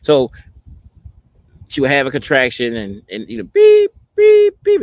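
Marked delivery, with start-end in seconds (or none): 3.4-4.8 high, loud electronic beep; imitated monitor beeps. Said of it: "then it would just drop like".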